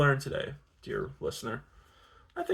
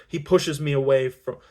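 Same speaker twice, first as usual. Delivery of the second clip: plain reading voice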